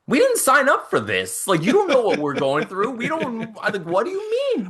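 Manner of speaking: high, squeaky mock disbelief; a hammed-up "are you serious" to a stranger; mock exasperation. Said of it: "And we're like".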